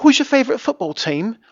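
imitating a bouncy British woman, high-pitched; lilting, bouncy mock-British accent; sing-songy British accent. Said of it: "she was very sing-songy like that".

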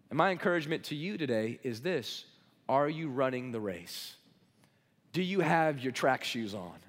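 usual speaking voice

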